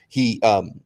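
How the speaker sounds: normal talking voice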